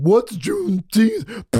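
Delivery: high, drawn-out mock voice of the clueless host; mocking Ben. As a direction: slowly tease a friend with a put-on dopey question. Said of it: "Ben said".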